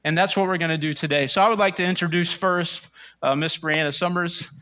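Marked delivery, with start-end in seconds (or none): none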